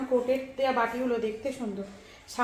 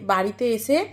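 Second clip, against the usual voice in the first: ordinary talk, nothing marked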